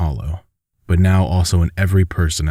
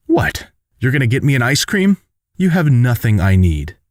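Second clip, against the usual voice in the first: higher-pitched, indignant retort; escalating temper; 6 semitones higher than usual. A narrator's reading. Daughter to her apologetic father, escalating temper. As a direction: throw it back with rising temper. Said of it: "Ursi's temper was escalating".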